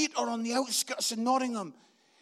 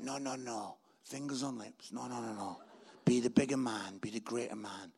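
low, soft restraining murmur; God's voice; 12 semitones lower, 8 dB quieter than usual. Retold or imitated. God holds back the preacher from confronting critic, quiet and low. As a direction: quietly hush him, deep and measured. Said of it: "And God's like".

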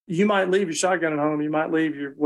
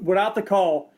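ordinary delivery

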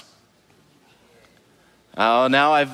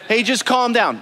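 mock calm down; 6 semitones higher than usual. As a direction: brush them off with a breezy calm down. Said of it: "People hate being told to calm down".

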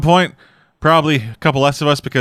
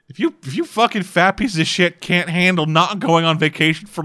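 normal talking voice

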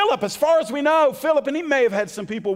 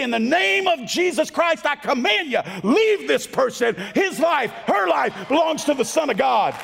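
commanding evil; 2 semitones higher than usual. Acted. Believer ordering evil to leave a person, commanding. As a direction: order it out with full authority. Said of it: "you can look evil in the eye and you can say".

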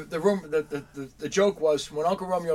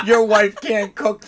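mock gripe; 5 semitones higher, 11 dB louder, slower than usual. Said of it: "But all I heard was".

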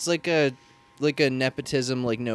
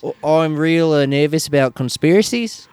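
mock Kiwi voice, a little higher; lightly put-on New Zealand accent; 3 semitones higher than usual. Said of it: "I just wanna keep doing the New Zealand voice".

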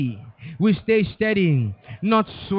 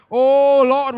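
loud cry; 3 semitones higher, 7 dB louder than usual. Real, not acted. Outcry in fervent prayer.

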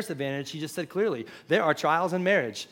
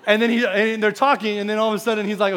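louder and higher, laughter in the voice; still laughing; 5 semitones higher, 9 dB louder than usual. Real, not acted. Pastor to the congregation, laughing as he goes on. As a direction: pick the story back up while laughing. Laughing while resuming story.

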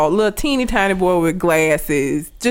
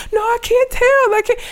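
much higher, pleading scared little voice; terrified kid; 13 semitones higher than usual. Retold or imitated. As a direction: imitate a frightened boy refusing to snitch. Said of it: "he was so terrified".

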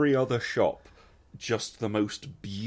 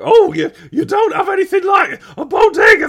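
very high, loud mocking shout; hammed-up, incredulous New Yorker at full volume; 17 dB louder than usual. Mimics boastful New Yorkers.

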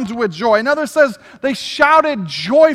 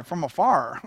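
East Texas twang; 9 semitones lower, 8 dB quieter than usual. Retold or imitated. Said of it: "I keep saying it that way".

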